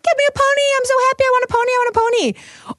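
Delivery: put-on high, eager little-girl voice wanting a pony; a small child pleading for a pony. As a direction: squeal it like a five-year-old begging for a pony. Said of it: "a little girl who is five and wants a pony and is like".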